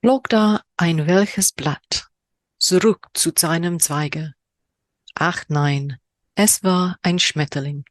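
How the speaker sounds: slow, measured poetry reading; poem reading in German. Shown as a demonstration. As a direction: read the haiku slowly, savouring it. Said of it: "my horrendous German accent".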